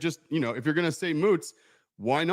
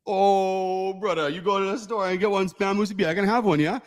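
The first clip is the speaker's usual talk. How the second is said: Hawaiian pidgin, higher and a bit louder, ending on a rising "yeah?"; 8 semitones higher, 4 dB louder than usual. Shown as a demonstration. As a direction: play a local Hawaiian asking his brother a favour. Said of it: "Hawaiian pidgin example with rising yeah".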